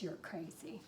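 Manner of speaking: plain reading voice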